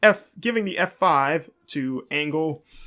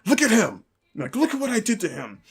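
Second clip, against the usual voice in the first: mock angry demand, pitched up; retold anger; 7 semitones higher than usual. Wrestler forcing his manager to the ground, mock angry. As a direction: imitate a furious wrestler, voice raised in pitch. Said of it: "very angrily and is like".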